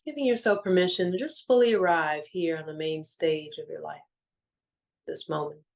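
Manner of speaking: measured, soothing meditation voice with long pauses; guided meditation. Real, not acted. Guiding listeners to let go of the day, calm meditation voice. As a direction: ease listeners gently into stillness, phrase by phrase.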